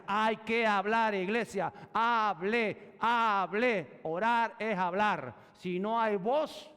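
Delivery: loud, slow preaching push; forceful preaching. Acted. Pastor exhorting church to pray aloud, forceful preaching. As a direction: drive it home to the church.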